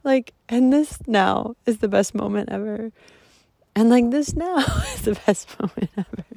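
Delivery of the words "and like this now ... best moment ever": higher, tearful laughing voice; laughing through tears. Real, not acted. Moved by the moment, laughing through tears. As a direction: let joy and tears mix. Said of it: "Oh my God, I'm crying".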